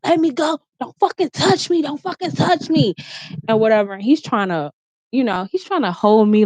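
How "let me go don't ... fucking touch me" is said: much higher mock frantic yell; retold yell. Her swinging at him, high mock frantic yelling. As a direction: fight him off in the retelling. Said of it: "I'm like".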